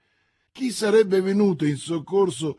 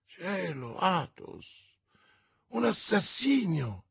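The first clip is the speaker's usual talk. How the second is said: esclamazione sconvolta; 4 semitones higher, 4 dB quieter than usual. A narrator's reading. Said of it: "esclamò D'Artagnan".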